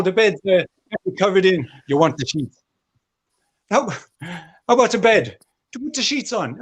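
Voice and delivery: high-pitched street pimp patter; Michael O'Leary impression. Comedian as airline boss turned street pimp touting to lads, high impression voice. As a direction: pitch it high, hustling punters with prices. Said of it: "with a impression".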